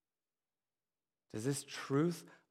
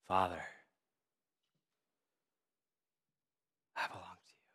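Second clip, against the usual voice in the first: slow breath prayer; 4 semitones lower, faster than usual. Shown as a demonstration. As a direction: breathe the words out gently. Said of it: "As you inhale, inhale the name of God".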